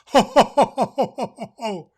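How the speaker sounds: high, loud jolly Santa laugh fading out; a big belly-laugh ho ho ho. Acted. Santa laughing at Megan's letter, jolly ho ho ho.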